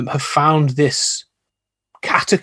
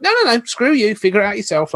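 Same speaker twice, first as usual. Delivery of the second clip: dismissive Lovecraft; 7 semitones higher than usual. Retold or imitated. Lovecraft brushing off the reader, mock dismissive and high. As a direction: wave the reader away, mockingly. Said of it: "he's like".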